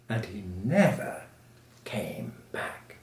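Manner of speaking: low, soft, dropping almost to a whisper; hushed narration. A narrator's reading. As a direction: let it fade with finality. Hushed close of the chase.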